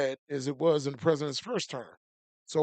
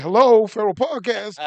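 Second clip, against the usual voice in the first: imitating a loud greeting; 5 semitones higher, 11 dB louder than usual. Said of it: "He's like".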